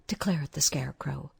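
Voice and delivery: ordinary delivery, nothing marked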